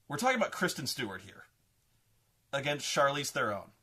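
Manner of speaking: ordinary delivery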